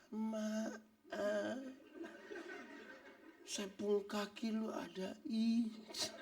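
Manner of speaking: mock the meek over-gentle excuse me; overly polite apology. Shown as a demonstration. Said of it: "bukan omong pelan lah".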